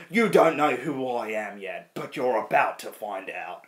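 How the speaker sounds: slow, menacing phone threat; Jason Statham. Retold or imitated. Villain rings his enemy after the crash, slow menace. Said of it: "he rings Vin Diesel saying".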